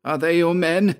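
high, impatient repeated question; insistent, high-pitched demand. A narrator's reading. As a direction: press for an answer, pitched well up. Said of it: "he repeated impatiently".

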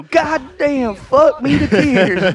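jokingly yelling as if burnt; mock cursing. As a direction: stretch out the swearing in hammed-up pain. Imagined swearing in pain.

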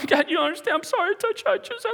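very high, hurried apology; acted frantic pleading. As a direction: babble an apology in panic. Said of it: "She's going".

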